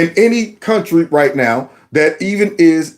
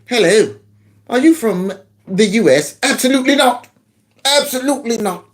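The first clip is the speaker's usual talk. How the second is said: fake English accent; 5 semitones higher than usual. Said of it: "go to England".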